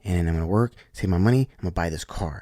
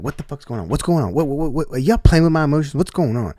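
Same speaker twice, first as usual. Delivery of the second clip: exasperated, rising louder and higher into rapid questions; retold annoyance; 7 semitones higher, 8 dB louder than usual. Inmate recalling his confusion at the surprise release, annoyed. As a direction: replay an agitated demand for answers, stumbling on "what". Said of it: "I was so annoyed".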